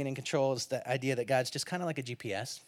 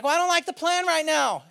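higher, pleading and needy; whiny plea to God; 10 semitones higher, 3 dB louder than usual. Pretend. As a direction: beg God for reassurance. Driver complaining to God.

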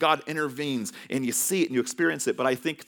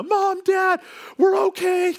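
put-on high, loud frantic kid shout; 7 dB louder, slower than usual. Boys running in to parents, high frantic shout. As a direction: yell for the parents in a squeaky panic.

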